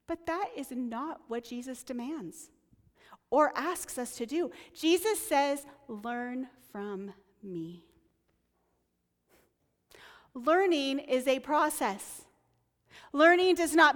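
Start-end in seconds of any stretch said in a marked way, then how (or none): 5.9-7.8 soft, slow, tender quote; quiet, slow and tender; gentle invitation. Retold or imitated. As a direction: offer it softly and slowly, like an invitation. Quoting Jesus's gentle invitation.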